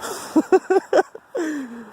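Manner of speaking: high, delighted burst of laughter. Real, not acted. Watching the burnout, he bursts out laughing high. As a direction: crack up in real delight.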